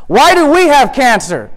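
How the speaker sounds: high, anguished questioning of God; impassioned protest. Acted. Believer asking God why others have it better, impassioned protest. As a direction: build to a pleading, indignant cry.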